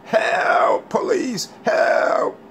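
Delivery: thin breathy mock cries for help; mock terrified screaming. Mocking Richie squealing for the police. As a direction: squeal help like a scared wimp. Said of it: "You'll be screaming".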